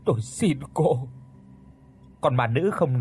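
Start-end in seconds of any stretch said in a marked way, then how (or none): 0.1-1.1 weak, strained begging; a man at his last gasp pleading; feeble, dying plea. A narrator's reading. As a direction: beg over and over with failing breath. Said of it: "thoi thóp thều thào không ngừng cầu xin".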